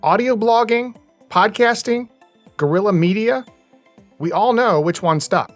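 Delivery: crisp, punchy podcast intro read; scripted intro narration. Acted. Host telling listeners how podcasting got its name, scripted narration. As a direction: narrate it like a documentary opener with lifted questions.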